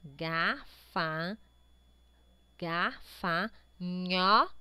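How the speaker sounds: gafanhoto very slowly, ga-fa-nho-to; syllable by syllable. Shown as a demonstration. Teacher checks the last syllable, syllable by syllable. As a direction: pause long between the pieces of gafanhoto. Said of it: "A última sílaba é formada".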